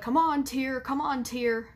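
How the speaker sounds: hammed-up coaxing of a fake tear; mocking fake crier. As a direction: sneer at someone begging for a tear. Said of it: "YouTubers that are just sitting there like".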